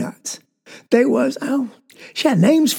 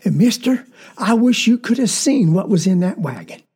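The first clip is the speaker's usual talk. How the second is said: ordinary delivery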